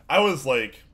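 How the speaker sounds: words cut by a sneeze; sneezing. Real, not acted. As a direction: sneeze mid-sentence. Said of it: "sorry, I sneezed".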